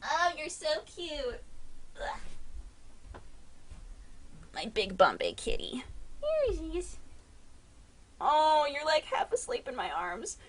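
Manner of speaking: high, soft cooing. Real, not acted. Owner cooing to her sleepy cat, soft and high.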